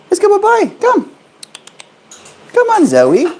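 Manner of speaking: very high squeaky pet voice; talking to a pet bird. Real, not acted. Calling bird out of cage.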